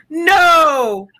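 high, loud, hammed-up indignant rant; theatrical fury, voice cracking at the end; mock outrage. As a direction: play the wounded Texan to the hilt. Outraged Texan defending herself.

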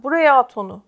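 loud, mildly acted shout. A narrator's reading. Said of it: "diye bağırdı Scrub tekrardan". (yüksek sesle seslenerek)

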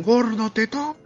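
high, teasing schoolyard taunt; mocking taunt. Kids taunting the new fat boy, high mocking jeer. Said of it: "todos le decían".

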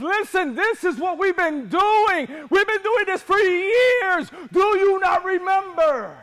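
high, slow shouted appeal; impassioned plea. Acted. Widow reminding the prophet of years of service, impassioned shout. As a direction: cry it out, stretching the words in protest.

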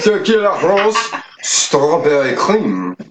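hammed-up, badly faked French accent; shitty French accent. Host naming the liqueur for free publicity, mock French accent. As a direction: put on a bad French accent, drawing out the last words. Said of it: "going with a French accent on that. A shitty one at that".

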